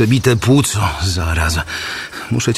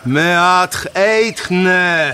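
calling out; 7 semitones higher than usual. Acted. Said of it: "Zawołał ponownie".

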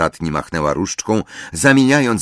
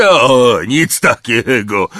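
Hagrid mumbling; 5 semitones higher, 6 dB louder, slower than usual. A narrator's reading. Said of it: "mruknął Hagrid".

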